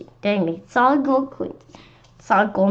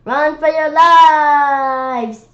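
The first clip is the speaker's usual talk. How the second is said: very slow, loud, higher drawn-out yell; panicked shout; 7 semitones higher, 8 dB louder, much slower than usual. Acted. Neil warning the kids, drawn-out panicked shout. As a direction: bellow it, stretched and frantic. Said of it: "shouted Neil".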